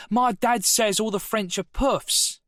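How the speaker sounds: mock the class like a smug fifteen-year-old; cheeky schoolboy.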